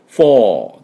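British フォー with no R at the end; British "four". Shown as a demonstration. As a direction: say フォー the British way, long open vowel. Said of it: "イギリス音だとフォーです". (イギリス音のフォー、最後のRなし)

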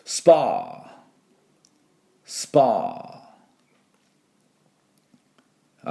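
スパー with a long, drawn-out final vowel; model word スパー. Shown as a demonstration. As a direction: say スパー slowly, stretching the ending. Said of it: "行きましょう". (スパー、語尾を長く伸ばして)

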